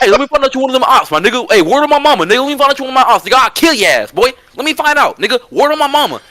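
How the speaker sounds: fast, high New York street threat; New York tough talk, rattled off quickly; New York accent. Retold or imitated. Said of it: "let me put my New York accent".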